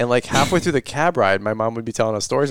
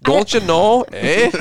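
put-on Midwestern-Canadian accent; 9 semitones higher, much slower than usual. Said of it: "chip off the old block".

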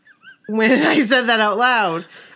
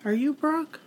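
soft mock search; 4 semitones higher, 7 dB quieter than usual. Imitating searching for friend.

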